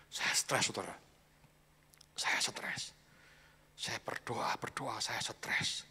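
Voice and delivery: slow breathy whisper, worn out; whispered confession. Retold or imitated. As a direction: whisper it as if exhausted. Pengakuan stres yang dibisikkan. (bisikan lelah penuh keluhan)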